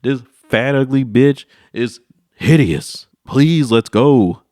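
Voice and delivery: loud, slow desperate protest; hammed-up loud plea; frantic plea. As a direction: insist hard while begging to leave. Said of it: "Whole time I'm like".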